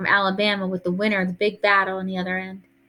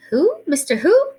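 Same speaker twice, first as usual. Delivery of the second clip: high, loud, incredulous questions; startled mama; 10 semitones higher, 5 dB louder than usual. A narrator's reading. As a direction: pitch leaps up in disbelief. Mama startled by Grandma's news.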